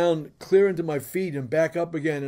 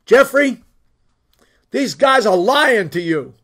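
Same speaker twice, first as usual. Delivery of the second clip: retold angry outburst, loud and higher; mad wife; 6 semitones higher, 10 dB louder than usual. Wife telling husband these guys lie, mad and loud. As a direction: voice a wife loudly warning her husband. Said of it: "and she's mad".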